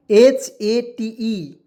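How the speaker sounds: each letter spelled very slowly and loud; slow loud spelling. Shown as a demonstration. Teacher spells HATE for the class, very slow and loud. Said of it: "teacher spells out HATE".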